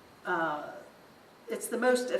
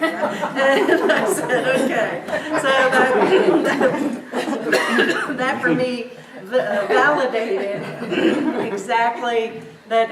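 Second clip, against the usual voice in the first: laughing loudly through the words, louder; real, helpless laughter while telling it; 3 semitones higher, 9 dB louder, slower than usual. Real, not acted. Laughing at the psychic's message.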